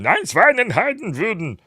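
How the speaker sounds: high, loud fake German gibberish; hammed-up German soldier babble; mock German. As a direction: spout made-up German like a panicked soldier. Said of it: "I can't speak German".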